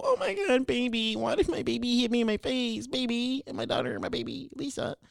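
high wailing mock mom. Mocking mom crying over being hit, high wail. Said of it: "she's gonna go".

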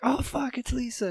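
very high, squeaky-pitched amazed quote; mock astonishment. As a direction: act out a stunned discovery, voice way up. Groom's surprise at lifting the veil.

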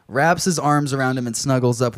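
ordinary talk

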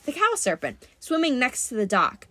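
plain reading voice

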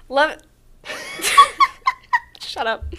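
trying not to laugh. Real, not acted.

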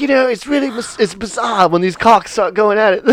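lightly put-on British voice; imitating a British man. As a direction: play an earnest British guy, amused underneath. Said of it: "this British guy is there".

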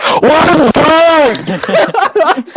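mock scream, very high and loud; yelling customer. Customer blasting the headset at drive-thru, mock high yell. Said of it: "you don't have to yell".

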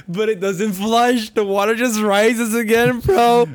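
loud, high, anguished complaint; shouted, drawn-out exasperation; exasperated ranting. Real, not acted. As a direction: wail it over the interruption. Exasperated retelling over co-host's interruption.

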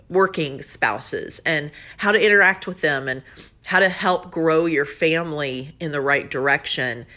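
ordinary talk, nothing marked

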